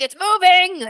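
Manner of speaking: high, strange insect voice; praying mantis voice. Retold or imitated. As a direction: voice a mantis watching its prey. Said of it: "I'm loving your mantis impression".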